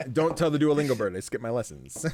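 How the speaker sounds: ordinary talk, nothing marked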